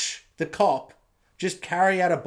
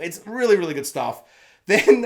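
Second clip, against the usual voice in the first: ordinary talk, nothing marked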